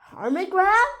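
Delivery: high, rising disbelief; surprised question. A narrator's reading. Lion recognising the lost friend, surprised rising question. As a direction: ask it with a sharp lift at the end.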